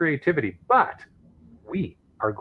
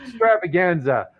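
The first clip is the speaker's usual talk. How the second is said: hammed-up sales pitch; 5 semitones higher than usual. Host hawks a geocache extravaganza to listeners, hammed-up sales pitch.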